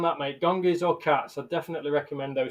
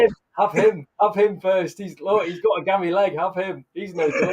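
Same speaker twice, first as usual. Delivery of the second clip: put-on pushy kid voice; 6 semitones higher, 4 dB louder than usual. Acting out picking a team.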